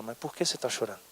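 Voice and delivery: very soft, low-pitched question; tender and quiet; gentle questioning. Acted. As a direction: press gently, almost in a hush. Jesus gently repeats his question.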